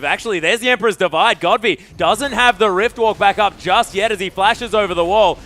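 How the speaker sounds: loud, high esports fight call; urgent caster following the chase; excited play-by-play. Real, not acted. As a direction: build to a shouted peak, then ease off.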